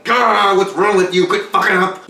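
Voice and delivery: an everyday fed-up parent snapping; exasperated parent. Retold or imitated. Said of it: "she didn't say it like".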